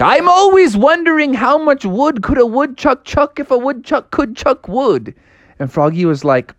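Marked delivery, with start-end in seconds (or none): 0.0-5.1 high, pondering rabbit gentleman; bunny gentleman voice. Acted. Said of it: "And he's like".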